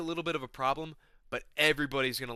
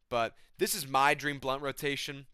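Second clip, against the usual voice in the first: ordinary talk, nothing marked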